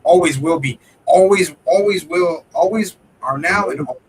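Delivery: emphatic loud vow. Real, not acted. Friend vowing his parents are always his heroes, loud and emphatic. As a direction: ring it out loud, stretching each always.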